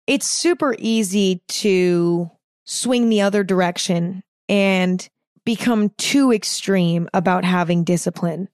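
normal talking voice

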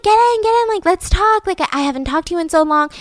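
high-pitched imitation of eager welcome; eager invitation. Barbara urging Margaret into the car, imitated eager invitation. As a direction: act out hustling a friend in.

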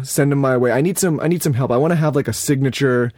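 ordinary talk

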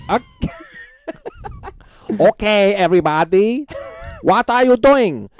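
high, loud put-on Asuka voice with broken English; Asuka impression. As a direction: play the wrestler who thinks it's all real. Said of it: "Her name is Asuka".

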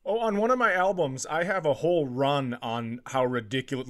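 ordinary talk

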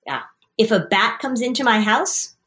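ordinary talk

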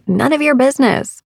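slightly defensive. Retold or imitated. Student wanting to answer her yoga teacher back, slightly defensive. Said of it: "felt slightly defensive and wanted to say".

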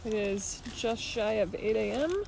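hoarse, barely any voice left; raspy whisper. Real, not acted. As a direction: voice worn out by trail dust. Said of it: "I no longer have a voice".

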